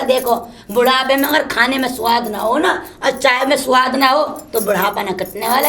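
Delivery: usual speaking voice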